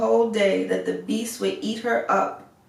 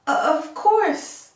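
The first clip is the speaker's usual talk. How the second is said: shaky politeness; 7 semitones higher, 3 dB louder than usual. A narrator's reading. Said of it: "but with a shaking voice".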